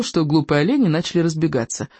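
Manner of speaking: ordinary delivery, nothing marked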